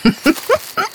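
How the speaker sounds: short bright rising laugh; a quick high giggle at the warning; girl's laugh. Acted. Hannah laughs off the elf's warning, short high giggle. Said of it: "Ich lachte".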